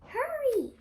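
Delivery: urgent call. Acted. Urging Jack to run.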